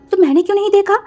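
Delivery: high loud surprised question; startled high question. Acted. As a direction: ask in disbelief.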